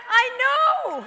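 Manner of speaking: loud, high cry of frustration; blurting it out with despair; exasperated outburst. Retold or imitated. Telling the woman on the bus, frustrated and shouting.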